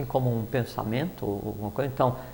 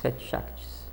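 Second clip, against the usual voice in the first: whispered name; 8 dB quieter, slower than usual. Real, not acted. Softly naming the seven Shaktis.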